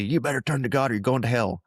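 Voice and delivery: mock turn-or-burn preacher. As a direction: imitate a pushy doom preacher. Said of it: "the turn or burn mentality".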